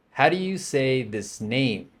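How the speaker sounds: ordinary talk, nothing marked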